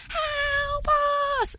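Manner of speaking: high, soft, drawn-out wail; eerie distant cry. Retold or imitated. Mysterious noise heard in a dark campsite, eerie faint cry. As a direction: cry for help faintly from far away, stretched out high. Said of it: "And all the while".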